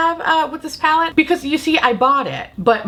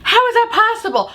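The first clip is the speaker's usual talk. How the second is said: outraged disbelief; 13 semitones higher, 3 dB louder than usual. Real, not acted. Outraged rant about lost points.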